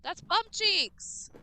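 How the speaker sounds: That's bum cheeks! shouted high and slow; loud complaint. Real, not acted. Streamer complaining loudly about the game, slow disgusted yell.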